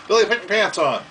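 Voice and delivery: calling out. Real, not acted. Calling to his son.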